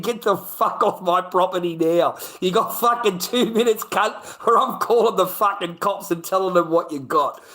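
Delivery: retold angry wife, high-pitched and excited; high, worked-up mock telling-off; storming wife's ultimatum. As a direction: imitate a wife ordering someone off her property. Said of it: "My wife stormed out to the garage".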